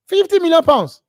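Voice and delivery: high, loud incredulous cry; outraged disbelief. Real, not acted. Ranting at a price tag, shocked and loud.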